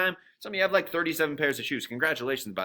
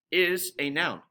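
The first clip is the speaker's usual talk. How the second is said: ordinary talk, nothing marked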